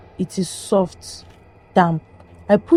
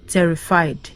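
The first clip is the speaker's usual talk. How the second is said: plain reading voice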